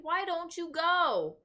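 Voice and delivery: high, slow insistent cry; girl demanding. A narrator's reading. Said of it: "Sophie cried".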